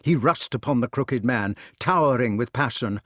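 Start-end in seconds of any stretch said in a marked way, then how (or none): none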